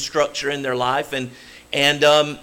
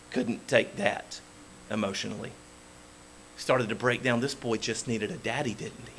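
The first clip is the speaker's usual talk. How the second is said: hushed, moved; 3 semitones lower, 12 dB quieter than usual. Real, not acted. Emotional tender point in sermon.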